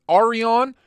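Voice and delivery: "Arion" as AR-ee-on, first vowel open AH; trial pronunciation. Shown as a demonstration. Streamer asks how you say it, offering "Arion" as AH-ree-on. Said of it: "how the fuck do you say it".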